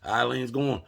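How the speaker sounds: ordinary talk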